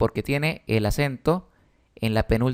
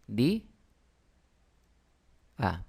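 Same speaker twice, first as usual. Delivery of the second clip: "Di a" as two separate syllables, long gap between; "Di... a", softly, each syllable on its own; split into syllables; 3 semitones lower, 5 dB quieter than usual. Shown as a demonstration. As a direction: pull "Di a" apart syllable by syllable. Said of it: "Lo mismo ocurre acá".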